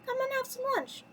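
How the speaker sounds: very high little-teacup voice; high-pitched child character; Chip. A narrator's reading. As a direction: pipe up high to cheer a friend. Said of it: "Chip said".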